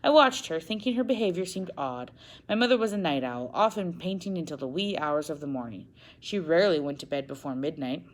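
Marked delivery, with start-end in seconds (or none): none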